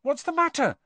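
high, startled question; alarmed what's-wrong. A narrator's reading. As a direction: ask it high, taken aback. Startled question to frightened friend.

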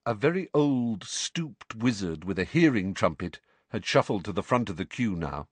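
usual speaking voice

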